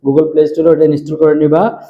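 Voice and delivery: ordinary talk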